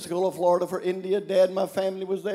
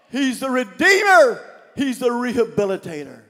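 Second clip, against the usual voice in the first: rising shout of praise; fiery sermon shout; preaching at full force; 6 semitones higher, 10 dB louder than usual. Real, not acted. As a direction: preach it out loud. Preaching climax.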